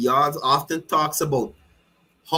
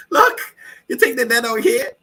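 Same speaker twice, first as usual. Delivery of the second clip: laughing; 8 semitones higher, 4 dB louder than usual. Real, not acted. Laughing at the rally.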